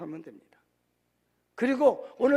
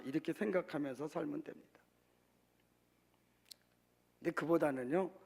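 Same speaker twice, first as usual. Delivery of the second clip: normal talking voice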